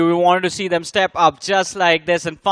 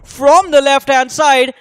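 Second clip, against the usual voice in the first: louder, higher hyped play-by-play; esports caster; 5 semitones higher, 5 dB louder than usual. Real, not acted. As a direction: ride the hype of the play. Caster calls the outplay.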